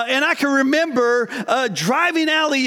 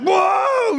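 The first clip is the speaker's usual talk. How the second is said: startled whoa; 7 semitones higher than usual. Retold or imitated. Dad recalling his daughter flooring the car, a startled high whoa. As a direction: yelp it as the car lurches off.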